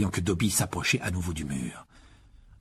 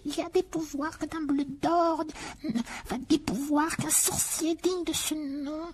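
high, slowed elf murmur; urgent, hushed high elf voice; slower than usual. Acted. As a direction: press the warning like a secret. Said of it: "se transforma en un murmure pressant".

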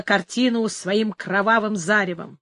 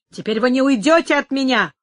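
raised, menacing challenge; threatening cry; 3 semitones higher, 4 dB louder than usual. A narrator's reading. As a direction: warn a cornered rival. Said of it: "вскричал Мануэль". (угрожающий возглас)

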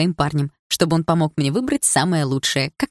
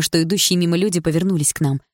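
ordinary delivery, nothing marked

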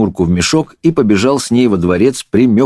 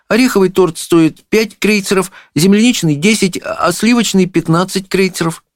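put-on high girlish listing; high-pitched little princess; princess, matter-of-fact; 12 semitones higher than usual. A narrator's reading. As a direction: reel off prices in a high voice. Princess lists cake prices.

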